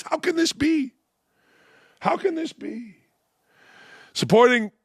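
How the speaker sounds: high, shouted hammed-up outrage; mock horror. Host mocking the Daily News with shrill high fake horror. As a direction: play a shocked liberal, way over the top.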